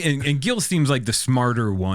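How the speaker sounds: usual speaking voice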